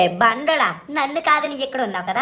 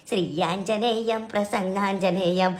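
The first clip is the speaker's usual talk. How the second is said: frightened prayer; 8 semitones lower, 5 dB quieter, slower than usual. Acted. Scared boy mutters a prayer.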